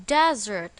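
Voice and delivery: "desert" with the stress on its first syllable, DEZ-ert. Shown as a demonstration. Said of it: "The stress is on the first syllable".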